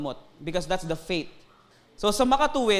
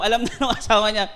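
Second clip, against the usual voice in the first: 1 laughing; 3 semitones higher, 6 dB louder, faster than usual. Real, not acted. Laughing while telling.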